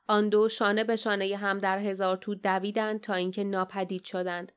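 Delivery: plain reading voice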